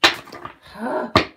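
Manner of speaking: loud, heavy huff of breath; exasperated sigh. Real, not acted. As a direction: heave a big exasperated sigh. Sighs over the spill.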